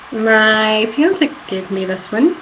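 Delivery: ordinary talk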